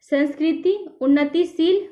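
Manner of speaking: ordinary talk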